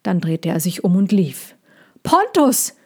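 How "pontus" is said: anguished shout; desperate call. Acted. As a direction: cry out after him as he leaves. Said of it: "rief der Zirkusdirektor ihn".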